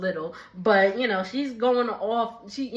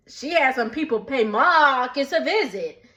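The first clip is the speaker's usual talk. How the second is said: normal talking voice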